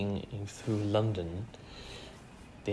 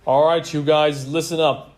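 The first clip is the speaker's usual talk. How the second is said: loud, raised coach's voice; calling to order; 6 semitones higher, 10 dB louder than usual. A narrator's reading. Coach calling his team to attention, loud and firm. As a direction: call out firmly to the group.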